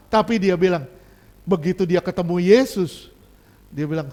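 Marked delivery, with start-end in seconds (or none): none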